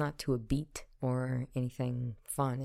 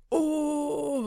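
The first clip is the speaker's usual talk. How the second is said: high mock exclamation; imitating YouTuber yell; 11 semitones higher, 3 dB louder than usual. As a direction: ham up a thumbnail face. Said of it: "They were always yelling and screaming".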